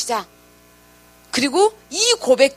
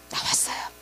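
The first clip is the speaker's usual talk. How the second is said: hushed reverent whisper; whispered reveal; 9 dB quieter than usual. Real, not acted. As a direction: whisper 나왔어요 as a hushed climax. Climax of the sermon point.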